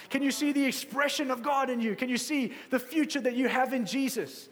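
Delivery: high, driving preacher's voice; urgent, rousing exhortation at high pitch; impassioned preaching. Real, not acted. Preaches at full force.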